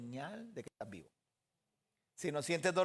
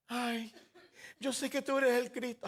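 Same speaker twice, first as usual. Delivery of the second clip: high-pitched imitation of a grieving woman; plaintive protest; 6 semitones higher than usual. Grieving woman answers Yeshua, plaintive protest. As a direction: raise the pitch as if lamenting.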